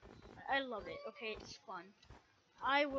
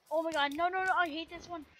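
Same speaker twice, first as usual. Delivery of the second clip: dismayed yell; 6 semitones higher, 10 dB louder, faster than usual. Real, not acted. Dreaded mob appears.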